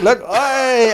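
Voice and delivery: high, loud retold whoop; excited mock shout. Friend showing off to the others, high excited shout.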